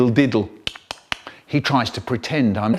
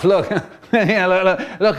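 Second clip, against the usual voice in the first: laughing; 4 semitones higher than usual. Acted. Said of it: "he starts to laugh".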